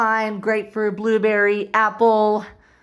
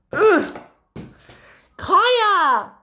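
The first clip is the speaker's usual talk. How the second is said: ordinary delivery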